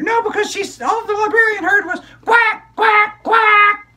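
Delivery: put-on high, bright child puppet storyteller; squeaky kid character excitedly telling a story; high-pitched puppet girl narrating. Puppet continues.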